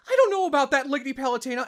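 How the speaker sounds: put-on pinched, high young angel soldier; Pit's voice. Shown as a demonstration. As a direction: pitch up into the young angel hero. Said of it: "getting into his voice are".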